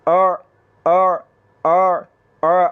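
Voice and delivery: आ from mid-throat with no glottal jolt; aa without jolt. Shown as a demonstration. Quran teacher demonstrating the smooth ain. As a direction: glide into आ smoothly, no catch. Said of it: "बग़ैर झटके के आपने पढ़ना है आ".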